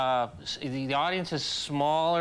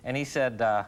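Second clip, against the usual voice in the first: normal talking voice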